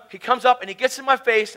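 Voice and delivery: ordinary talk, nothing marked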